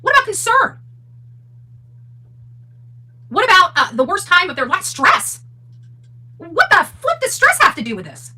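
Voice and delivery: high, loud incredulous rant; exasperated shouting. Real, not acted. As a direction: fire off each question in loud disbelief. Ranting at the family's post.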